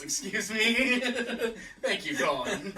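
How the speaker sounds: laughing through the words; embarrassed giggle after a slip. Real, not acted.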